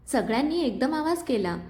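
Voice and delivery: plain reading voice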